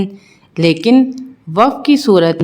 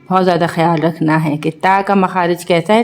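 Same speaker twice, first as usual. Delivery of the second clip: ordinary talk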